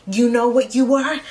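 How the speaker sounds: imitating an angry mom; retold mom yelling. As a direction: throw it at him like an insult. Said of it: "my mom's like".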